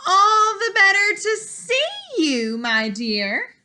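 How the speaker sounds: high, loud, cheerful fake granny; the disguised wolf sweetly answering. Wolf in disguise answering the girl, high cheerful voice. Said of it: "said the wolf".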